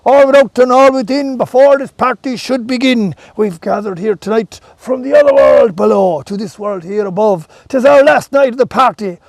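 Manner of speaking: loud ringing rhymed proclamation; proclaiming herald. Acted. Puck proclaims the fairy party to all, loud. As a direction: declaim it to the whole crowd, loud.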